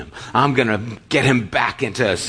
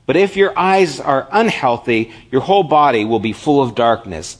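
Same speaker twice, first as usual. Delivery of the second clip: louder, higher preaching read; scripture read; 4 semitones higher, 4 dB louder than usual. Acted. Pastor reading Jesus' words to the congregation, proclaiming loudly. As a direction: proclaim the verse.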